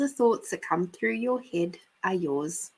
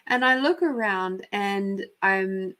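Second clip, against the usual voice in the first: normal talking voice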